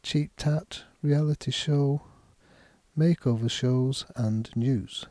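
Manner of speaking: each item stressed, a little down sound on news; list intonation. Shown as a demonstration. Teacher reads the list for learners, stressing each item. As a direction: read cheap tat, reality show, makeover shows, and news as a list with falling end. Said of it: "Listen to the words in a list".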